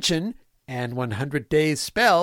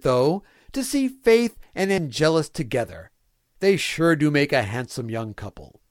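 ordinary delivery

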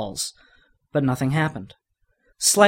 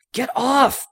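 strained, higher wheezing cry; 11 semitones higher than usual. Acted. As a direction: squeeze it out under a heavy weight. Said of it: "Billy wheezed".

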